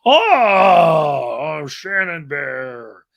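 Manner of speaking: a swooning, drawn-out coo; mock swooning. A bear swooning over the Shannon bear, hammed up. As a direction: moan it like a smitten bear.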